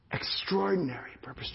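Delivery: hushed whispered repeat. Real, not acted. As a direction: whisper it for awe. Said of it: "whispered repeat for effect".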